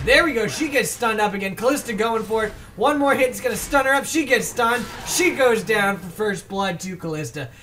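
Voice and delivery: hyped live fight commentary; excited play-by-play. Real, not acted. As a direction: call the kill as it happens, with rising excitement. Live play-by-play of first blood.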